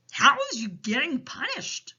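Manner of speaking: troubled storybook giant; Big Friendly Giant. A narrator's reading. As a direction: ask with big pitying emphasis. Giant questions Sophie.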